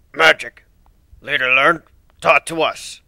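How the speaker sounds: low gravelly growl. A narrator's reading. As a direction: rumble it low and rough. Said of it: "in his low, gravelly voice".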